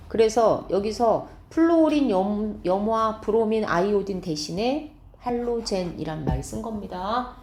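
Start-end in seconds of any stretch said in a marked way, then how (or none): none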